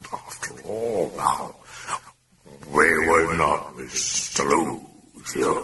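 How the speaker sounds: slow, strange demon drawl. Acted. Demon taunts Karras, slow and eerie. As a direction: speak as the mocking demon.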